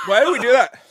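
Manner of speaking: high, loud tearful cry of regret; pained wail. Real, not acted. Cohost regretting the chip challenge, pained high wail. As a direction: wail it, really suffering.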